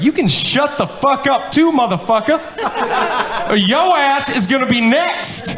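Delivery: hammed-up angry mother snapping back, high; mock furious mom. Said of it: "She's like".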